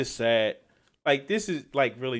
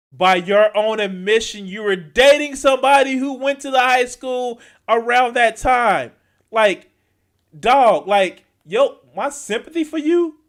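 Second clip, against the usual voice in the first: louder and higher, real exasperation; loud, high incredulous rant; heated rant; 9 semitones higher, 12 dB louder than usual. Real, not acted. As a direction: let the frustration boil over. Ranting at video subject.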